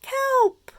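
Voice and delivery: high breathy call of a name; calling his name. Acted. Friends spotting Kelp return, high excited call. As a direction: cry out his name in joy.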